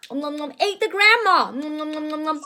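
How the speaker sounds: put-on gobble it up for the toddler; munching noises. Mother playing the wolf munching for Johan, fading.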